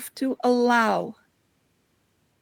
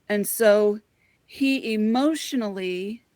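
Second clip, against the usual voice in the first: ordinary talk, nothing marked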